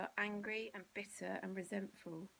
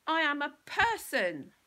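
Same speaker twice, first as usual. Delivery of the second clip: slow, higher, loud triumphant shout; triumphant yell; 7 semitones higher, 14 dB louder than usual. Acted. Bill yells his triumph at the bully, slow and loud. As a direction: proclaim it like a victory. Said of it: "then yelled triumphantly".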